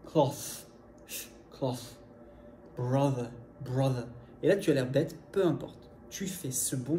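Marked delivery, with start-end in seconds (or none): 0.1-0.7 "Cloth" with tongue out, blowing the TH; TH soufflé exagéré. Shown as a demonstration. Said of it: "tu souffles sur tes dents du haut". 1.5-2.0 "Cloth" finishing on a voiceless, blown TH; tongue out, blow the end of "Cloth"; TH soufflé. Shown as a demonstration. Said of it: "tu souffles sur tes dents du haut". 2.8-4.1 "Brother" with tongue out for a voiced TH; TH vocalique exagéré. Shown as a demonstration. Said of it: "tu souffles sur tes dents du haut".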